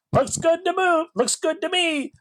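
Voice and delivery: high-pitched mock director giving the go-ahead; put-on high voice of approval; eager director. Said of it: "the director was like".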